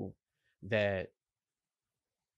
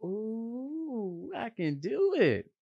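higher and louder, a sudden burst of confidence; excited self-belief; 9 semitones higher, 3 dB louder, much slower than usual. Retold or imitated. Speaker voicing his own newfound confidence, excited. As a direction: let the "Ooh" lift into a proud I can do it. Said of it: "You feel that".

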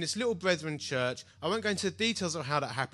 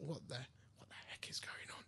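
hushed mock alarm; very soft, acted-out confusion; bewildered whisper; 6 semitones lower, 19 dB quieter than usual. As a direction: whisper it like a private stunned thought. Retold private bewildered thought.

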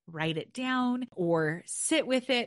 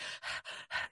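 a long soft audible exhale; long sigh out; 11 dB quieter than usual. Shown as a demonstration. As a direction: let the breath go in one long sigh. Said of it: "sometimes we do the same".

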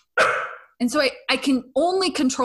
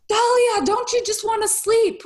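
exploding at the dog; 11 semitones higher than usual. Retold or imitated. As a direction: shriek it like your patience just snapped. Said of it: "me exploding".

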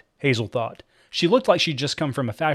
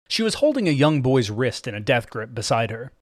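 ordinary delivery

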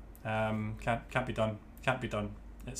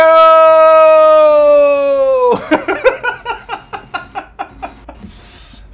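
high cackling laugh; big laugh shouted out; loud shrieking laugh; 12 semitones higher, 15 dB louder than usual. Real, not acted. Host laughs loudly after intro.

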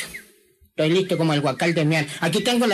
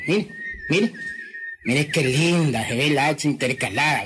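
usual speaking voice

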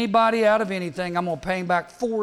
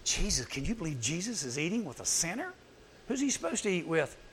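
muttering; 7 dB quieter than usual. Retold or imitated. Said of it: "these guys mutter".

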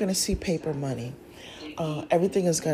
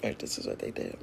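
hushed, breathy aside; whispering; 12 dB quieter than usual. Real, not acted. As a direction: whisper it like a secret.

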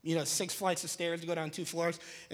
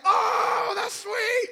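high, loud mock excitement; thrilled awe; 6 dB louder, much slower than usual. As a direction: exclaim in hammed-up delight. Thrilled at door kicked clean in.

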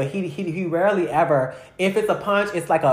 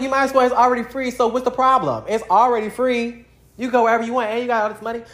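insistent; 8 semitones higher, faster than usual. Retold or imitated. Pushing dad to use benefits.

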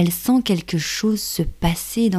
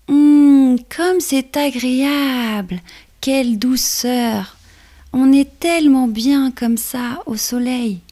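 high, slow, blissful ladybug; 6 semitones higher, 3 dB louder, slower than usual. Acted. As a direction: savour the warm sunshine. Ladybug enjoys the sun.

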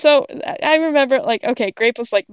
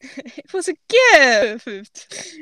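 very drawn-out, higher mock wail; mock lament; 4 semitones higher, much slower than usual. Echoing a friend's joke, drawn-out mock lament. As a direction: stretch it out in playful dismay.

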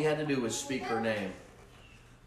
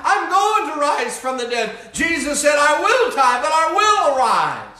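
full-force preaching; 13 dB louder than usual. Acted. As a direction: preach it at the top of your voice.

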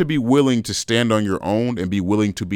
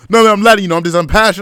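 high, loud mock Latin accent; bad Latin accent; 11 semitones higher, 8 dB louder, much faster than usual. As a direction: imitate a man apologising in a terrible Latin accent. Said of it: "my Latin accent's terrible".

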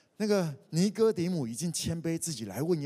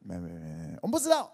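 flustered denial; 6 semitones lower, 4 dB louder than usual. Retold or imitated. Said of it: "约翰是谁".

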